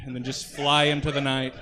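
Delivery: ordinary talk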